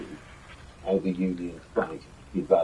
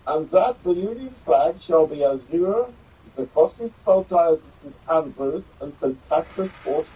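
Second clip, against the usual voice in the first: put-on slight German accent; 8 semitones higher, 4 dB louder than usual. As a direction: ham up a stiff German-accented George the Third. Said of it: "I'll try and do it in a slightly German accent".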